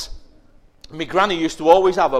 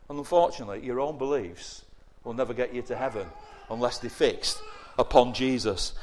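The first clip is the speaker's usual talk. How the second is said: usual speaking voice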